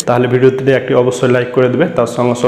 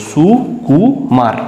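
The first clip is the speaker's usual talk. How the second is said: সু, then কু, then মার, each pronounced separately; teacher sounding out সু কু মার piece by piece; broken into syllables; 3 semitones higher than usual. Shown as a demonstration. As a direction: split it in three: সু ... কু ... মার. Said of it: "এটাকে তিনবার ভেঙে উচ্চারণ করতে হলো".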